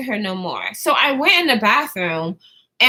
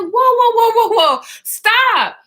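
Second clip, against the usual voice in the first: alarmed shouting; 8 semitones higher, 5 dB louder, slower than usual. Retold or imitated. Brother breaks up the fight.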